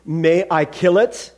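slow, measured angel's question; insistent angel. Acted. Angel asking permission to kill the lizard, insistent. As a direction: ask it slowly and firmly.

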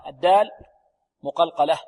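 ordinary talk

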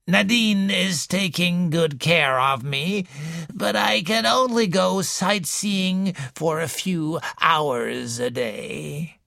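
put-on slow, wheezy old woman's voice; slow wheezing invalid complaining to a visitor; wheezing old lady. A narrator's reading. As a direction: wheeze it out like a sickly matriarch. Mrs Boynton's voice continues.

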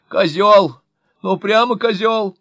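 high, very loud yell; shouting insult. A narrator's reading. Wife yelling at her husband, loud high shout. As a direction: hurl the insult at full volume. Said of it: "И крикнула ожидаемое".

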